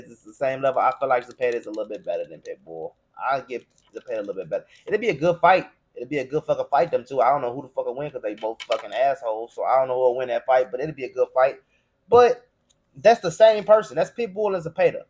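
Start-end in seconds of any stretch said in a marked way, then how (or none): none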